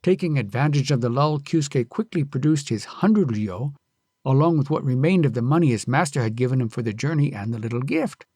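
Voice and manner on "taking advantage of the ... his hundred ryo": ordinary delivery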